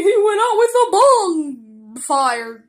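loud, drawn-out delivery; slow, hammed-up announcement; theatrical punchline. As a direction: stretch the pun out loudly. Acts out the pun to Mickey.

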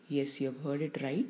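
normal talking voice

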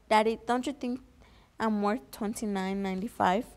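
plain reading voice

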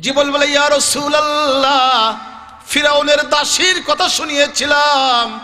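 high, chanting sermon delivery; full-force preaching. Acted. Prophet asks Gabriel about paradise's fragrance, high impassioned preaching. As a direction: cry out the dialogue with long-held endings.

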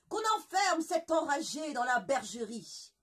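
loud angry royal command; furious king. Acted. Le roi furieux ordonne à ses gardes, en colère. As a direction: rage at the courtiers. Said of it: "Furieux, le roi déclara".

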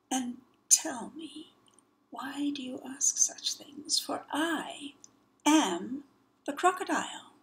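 soft, sly whisper; luring crocodile whisper. Acted. Crocodile whispering to lure the child. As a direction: coax him closer.

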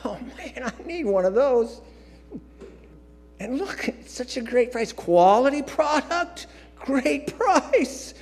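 high, gushing, amazed at the bargains; gushing high over a great price in the store; excited shopper. Retold or imitated. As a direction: play yourself wowed by a Costco deal. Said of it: "I go".

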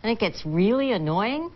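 "annoying" ending on a questioning rise; uptalk. Shown as a demonstration. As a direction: swing "annoying" up at the end. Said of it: "Every sentence ends in an up".

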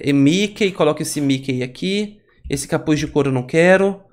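normal talking voice

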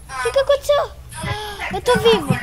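high crying shout; panicking gamer. Real, not acted. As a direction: wail it out in shock. Cry shout after attack.

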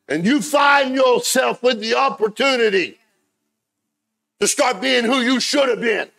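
high, loud, drawn-out pulpit shout; full-force preaching. Real, not acted. Preacher urges congregation to become who they should be, full-force preaching. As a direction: preach it up high, hammering each phrase.

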